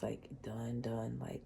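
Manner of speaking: whispering, overcome; choked whisper. Real, not acted. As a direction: let the word fall to a whisper. Emotional reading about crying nights.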